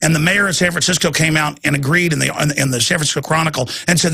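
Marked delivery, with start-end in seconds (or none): none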